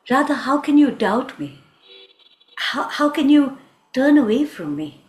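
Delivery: loud, emphatic appeal to a doubting lover; hurt pleading. Acted. Krishna asks Radha how she can doubt him, hurt. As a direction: plead with force, wounded.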